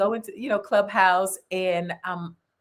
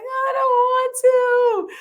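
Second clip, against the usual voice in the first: much higher, slow whiny protest; mock whiny refusal; 5 dB louder, much slower than usual. Telling the host she resisted YouTube, whining. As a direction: drag out the refusal like a child. Retold reluctance.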